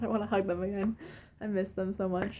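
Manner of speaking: real fond sigh of missing someone; wistful longing. Real, not acted. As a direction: let it sink into quiet yearning. Misses the band, wistful aside.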